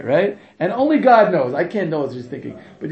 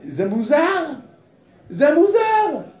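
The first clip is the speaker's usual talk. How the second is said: mock cry; 10 semitones higher, much slower than usual. Mock cry of the blind pious man.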